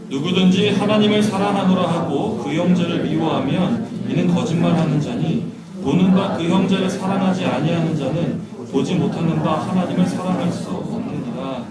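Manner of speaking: slow, loud unison reading of the verse; loud scripture reading. Acted. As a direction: read the verse out strongly, slowly, together. Scripture read aloud together. (큰 소리로 천천히 성경 봉독)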